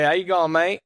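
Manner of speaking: Australian accent. Shown as a demonstration. Demonstrating the only greeting he knows, in an Australian accent. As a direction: show off a shaky attempt at Australian accent. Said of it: "I only know how to say".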